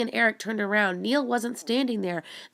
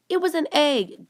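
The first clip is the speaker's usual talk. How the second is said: higher, raised shout of alarm; 8 semitones higher, 4 dB louder than usual. Acted. Julie scolding Neil about the egg, higher shout. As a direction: call it out sharply to warn him. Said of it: "Julie shouted".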